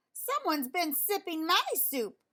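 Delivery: Mama Bear, upset about her soup, growls; Mama Bear growling. A narrator's reading. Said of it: "she growled".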